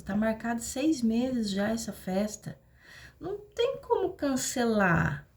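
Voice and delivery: sarcastic impersonation of a selfish son; mock excuse-maker. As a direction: mimic a stubborn guest defending his party. Mocking the excuses of a partygoer.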